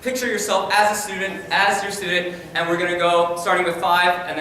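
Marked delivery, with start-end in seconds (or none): none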